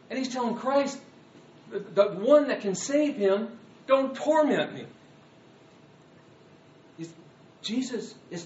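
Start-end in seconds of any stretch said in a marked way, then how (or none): 3.9-4.9 high, loud plea; tormented plea. Acted. Tormented man pleading with Christ, raised voice. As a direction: beg to be spared. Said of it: "This man is tormented".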